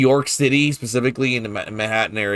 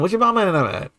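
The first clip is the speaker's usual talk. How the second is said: New Orleans accent; 7 semitones higher, faster than usual. Shown as a demonstration. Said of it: "this kind of stuff comes out of me more".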